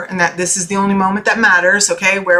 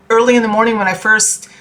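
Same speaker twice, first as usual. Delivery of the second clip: ordinary delivery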